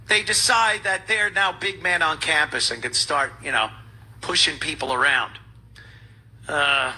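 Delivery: usual speaking voice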